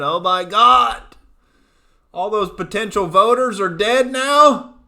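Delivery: loud, slow, high-pitched fake horror; mock shock. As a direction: react with hammed-up alarm. Mock shock at joke headline.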